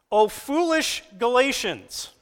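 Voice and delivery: high, slow, ringing reproach; Paul scolding his flock slowly, loud and high; fired-up rebuke. Acted. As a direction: call them fools from the pulpit.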